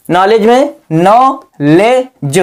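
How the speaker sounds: "नॉलेज" drawn out slowly, silent k, ending "ज"; slow pronunciation demo. Shown as a demonstration. Teacher to students, slow careful "नॉलेज". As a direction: spell out "नॉलेज" slowly as nol-ej for the class. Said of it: "जीई को जा पढ़ते हैं".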